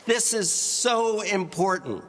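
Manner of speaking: loud demo of importance. Shown as a demonstration. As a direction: raise the volume to make the point. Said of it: "We've increased our volume".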